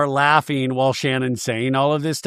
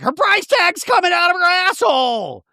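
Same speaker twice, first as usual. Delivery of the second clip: very high, piercing holler; mock hysterical shriek. [name] pointing out Shannon's price tag, mock hysterical. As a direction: screech it out in hammed-up glee.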